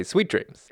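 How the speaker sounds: "Sweet" pitched high, then drop on "dreams"; imitating the co-host's high-pitched "Sweet"; stress on SWEET. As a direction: punch the first word, "Sweet", up high. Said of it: "looking forward to hearing you say".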